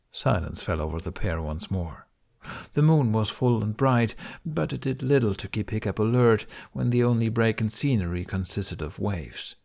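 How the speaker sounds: ordinary delivery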